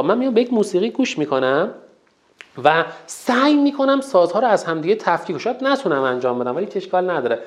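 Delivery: usual speaking voice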